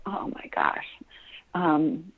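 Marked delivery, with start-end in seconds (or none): 0.1-0.9 a real, breathless whisper of emotion; overwhelmed whisper. Real, not acted. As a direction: let it slip out under your breath. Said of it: "it makes me emotional".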